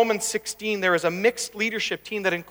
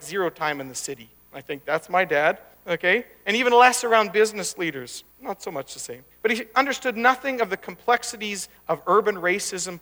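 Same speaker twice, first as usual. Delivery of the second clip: ordinary talk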